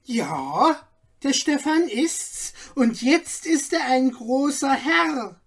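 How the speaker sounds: high, shrill-edged gossip, full of herself; piercing self-important voice. A narrator's reading. Gossip Margaret answers the crowd, piercing and self-important. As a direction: announce it like the town's know-it-all. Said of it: "mit wichtiger Miene und gellender Stimme".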